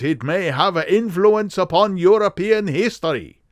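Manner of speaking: high, emphatic German nobleman; strong German accent. Acted. Count binding both men to secrecy, strong German accent. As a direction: proclaim it grandly, heavily German. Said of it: "a strongly marked German accent".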